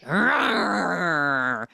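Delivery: long growling pull-cord engine rev; imitated motor noise. Imitating the cheap boat's pull-start motor, growled rev. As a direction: rev like a little boat motor. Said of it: "like, pull, and it's like".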